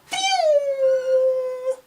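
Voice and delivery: high long whistling whoosh past the ear; whizzing whistle. Retold or imitated. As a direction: imitate a firework skimming your ear. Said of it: "All I could hear was".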